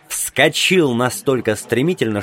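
plain reading voice

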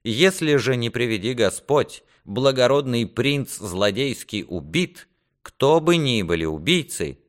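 a hint of solemn defiance, point by point; lightly voiced resolute squire. Squire states his terms to his lord, firmly resolute.

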